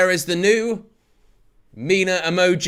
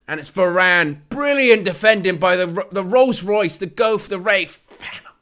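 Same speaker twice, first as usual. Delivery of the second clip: excited play-by-play, louder on the big moments; live match commentary; 7 semitones higher, 6 dB louder than usual. Acted.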